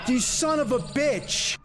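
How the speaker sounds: high, slow, drawn-out curse; angry cursing. Real, not acted. Gamer cursing a stubborn enemy, angry. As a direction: stretch the insult out, riled.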